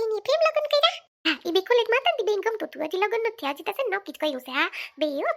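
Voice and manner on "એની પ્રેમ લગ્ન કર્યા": much higher, louder startled ask; surprised question. Acted. Mother hears the friend had a love marriage, amazed. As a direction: gasp it out in disbelief.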